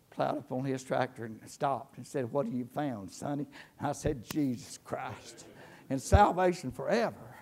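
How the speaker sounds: quiet, intimate conversion story; hushed testimony. Acted. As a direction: drop down low and tell it close.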